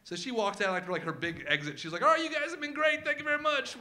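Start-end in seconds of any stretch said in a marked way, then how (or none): 2.0-3.8 high, loud, chirpy farewell to the crowd; showy little girl. Retold or imitated. Five-year-old thanking the dinner guests, high and chirpy. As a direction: take a bow like a tiny star. Daughter's big exit line.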